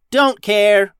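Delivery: high, loud brush-off; bossy captain shutting someone down; curt interruption. A narrator's reading. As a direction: cut in impatiently. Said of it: "interrupted Max".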